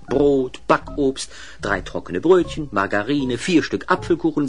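ordinary delivery

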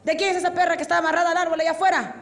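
high, fast demand; mock-official annoyed questioning; angry policeman. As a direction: play an officer demanding the owner step up. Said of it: "pasó un policía y se enojó".

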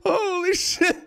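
high, squeaky voice cracking with laughter; losing it completely; tearful giggling disbelief; helpless laugh-crying. Real, not acted. Breaking down laughing at creature.

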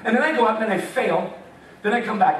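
ordinary delivery

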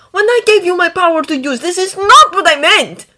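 high, loud reprimand; stern scolding. A narrator's reading. Dragon to the boy who misused his gift, scolding. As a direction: scold a child, building in force.

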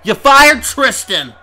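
high, loud, drawn-out accusation; outraged shout. Real, not acted. Commentator accusing bosses of firing Tristan, outraged shout. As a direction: hurl it out as an accusation.

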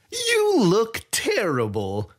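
put-on gloating thief greeting an old rival; gleeful Sai. A narrator's reading. Sai voiced by narrator.

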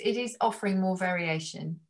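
usual speaking voice

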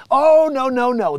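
loud, high sarcastic protest; mock alarm. Streamer mocking platforms that call him harmful, mock alarm.

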